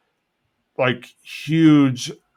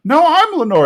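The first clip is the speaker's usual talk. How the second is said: high, loud insistence; hammed-up denial, high and forceful; protesting Bill; 5 dB louder than usual. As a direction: protest it in a pitched-up voice. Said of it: "And he's like".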